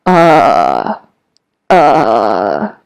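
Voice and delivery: uh dropped very low in the range; low caught-off-guard uh. Shown as a demonstration. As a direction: sink to your lowest note on uh. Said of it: "with a low voice".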